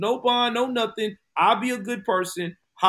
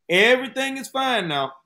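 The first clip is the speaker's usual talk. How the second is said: mock reassurance; 8 semitones higher, 4 dB louder than usual. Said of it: "her people had promised".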